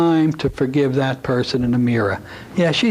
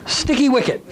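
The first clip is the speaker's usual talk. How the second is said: high, mock British officer saying sticky wicket; playful British accent; British war-movie accent; 10 semitones higher than usual. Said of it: "I watch the old war movies".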